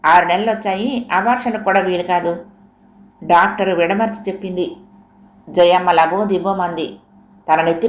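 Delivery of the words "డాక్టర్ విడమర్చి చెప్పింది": ordinary delivery, nothing marked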